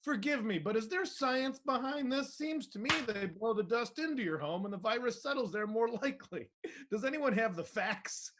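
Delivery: high, prim questioning voice; put-on worried busybody, pitched well up; mock concerned commenter. Reading out the first response.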